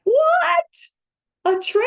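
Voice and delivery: very high, loud squeal of delight; squealing excitement. Retold or imitated. Told about a train in the orchard, high thrilled squeal. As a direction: shriek it like a kid who just got a treat. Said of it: "I was so excited".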